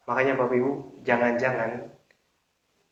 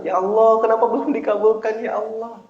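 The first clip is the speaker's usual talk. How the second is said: high, hurried mock complaint; impatient pleading; 5 semitones higher than usual. Preacher imitates someone who prayed this afternoon, impatient. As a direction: whine it to God, fading at the end. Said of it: "terus minta dikabulnya cepet".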